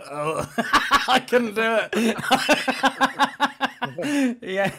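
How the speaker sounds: high-pitched helpless laughter; words squeezed out through laughing; laughing hard. Real, not acted. Caught on open mic, cracks up.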